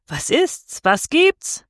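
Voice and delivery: high, rising startled questions; alarmed cry. A narrator's reading. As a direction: demand in alarm what is going on. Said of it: "schrie Jungfer Ludovike noch einmal".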